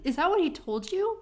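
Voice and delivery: high-pitched indignant question; incredulous challenge. Retold or imitated. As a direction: act out confronting someone in disbelief. Acting out quarrel at the bar.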